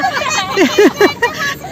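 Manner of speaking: a short high giggle, rising at the end. Real, not acted. Giggling high at her husband in the car.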